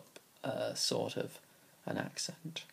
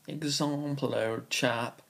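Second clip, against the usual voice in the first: mock posh English, example with a long ah; imitating a posh southern English accent; 3 semitones higher, 3 dB louder, slower than usual. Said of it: "most people in my area would say".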